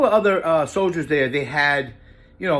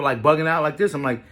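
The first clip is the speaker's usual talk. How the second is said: ordinary delivery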